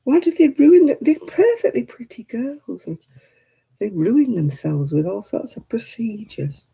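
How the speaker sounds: normal talking voice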